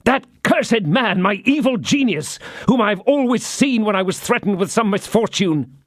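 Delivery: high, tense rush of dread and fury; feverish agitation. A narrator's reading. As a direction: pour out the fear of his evil genius. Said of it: "pale with passion".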